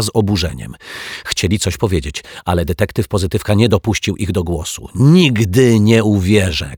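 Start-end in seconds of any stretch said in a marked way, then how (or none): 4.9-6.8 loud, slow, defiant shout; detective shouting in defence of the children. Acted. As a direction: shout it out, refusing to believe. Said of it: "krzyknął".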